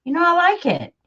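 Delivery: high, pleasantly surprised concession; imitated pleased verdict. Vlogger quoting herself at the finished journal, pleasantly won over. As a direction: lift the pitch, won over at last. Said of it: "I look at it and I say".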